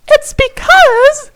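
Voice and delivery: very high, slow, squeaky voice; dramatic fairy voice. A narrator's reading. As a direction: build suspense like a tiny fairy. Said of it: "she paused".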